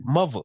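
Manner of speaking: "mother" said as muvva; New York "mother", v for th, no final r; up-north pronunciation. Retold or imitated. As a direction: put a v in the middle of "mother". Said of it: "people up north be like".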